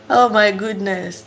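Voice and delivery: ordinary talk, nothing marked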